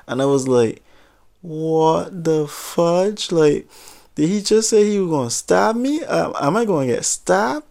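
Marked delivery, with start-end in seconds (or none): 1.4-7.7 high, drawn-out stunned voice; shocked disbelief. Retold or imitated. Intern hears he might get stabbed, stunned and high. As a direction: gasp it out, not believing the threat.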